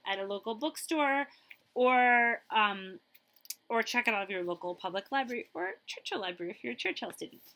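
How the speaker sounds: ordinary delivery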